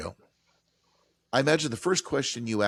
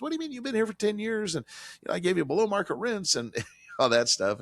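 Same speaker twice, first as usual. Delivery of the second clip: high-pitched, incredulous comeback; indignant protest; 12 semitones higher than usual. Acted. Landlord to a departing long-term tenant, indignant protest. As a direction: protest as if caught off guard and wronged.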